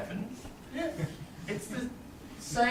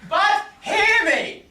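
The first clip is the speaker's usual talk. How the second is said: pleading cry; 10 semitones higher, 4 dB louder, slower than usual. Acted. Pleading husband cries out to wife.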